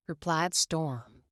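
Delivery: usual speaking voice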